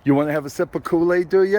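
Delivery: high, fast sing-song offer. Real, not acted. Offering drink to granddaughter.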